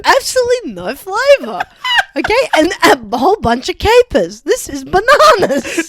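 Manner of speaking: hammed-up indignant diner; mock outrage. Customer complaining about capers, loud mock outrage.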